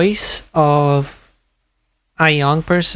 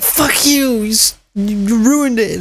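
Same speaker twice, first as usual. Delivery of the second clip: high, slowing mock yell; angry scammer yell; 6 semitones higher, slower than usual. Scammer blames the narrator for ruining it, high mock yell. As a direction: whine out a scammer's furious complaint, pitched high. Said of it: "He was like".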